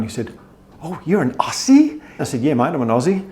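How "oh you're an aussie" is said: higher, slow questioning delivery; mock an intrigued American stranger; mildly surprised American. American stranger noticing an Aussie, mildly surprised.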